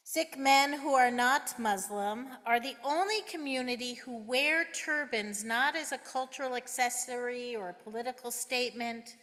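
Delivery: ordinary delivery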